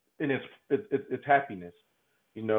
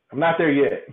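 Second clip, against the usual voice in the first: higher, louder, through a laugh; laughing admission; 3 semitones higher, 7 dB louder than usual. Real, not acted. Man confessing he still dislikes crying, laughing. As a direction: admit it with a real laugh.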